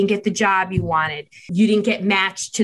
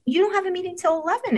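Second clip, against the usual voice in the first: imitating her son; 10 semitones higher, much faster than usual. Son arguing back in story.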